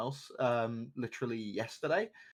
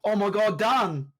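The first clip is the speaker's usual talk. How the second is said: loud, high-pitched burst of amazement; real hyped shout at a game moment; excited outburst; 8 semitones higher, 7 dB louder, much slower than usual. Real, not acted. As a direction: yell it out, stunned by the play. Reacting to a play on stream.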